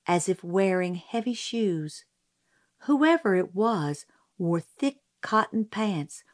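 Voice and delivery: ordinary delivery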